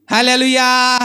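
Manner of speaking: loud Hallelujah call; hallelujah shout. Real, not acted. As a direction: cry it out to the crowd. Preacher calls out hallelujah. (బిగ్గరగా హల్లెలూయా)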